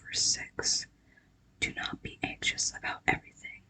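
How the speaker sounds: ordinary delivery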